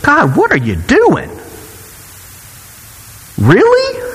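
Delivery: high, incredulous "Really?" repeated; mock-exasperated rant at God; exasperated complaint. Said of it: "this crying out".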